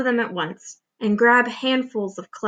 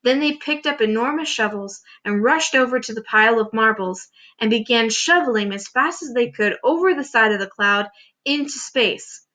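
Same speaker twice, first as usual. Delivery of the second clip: ordinary delivery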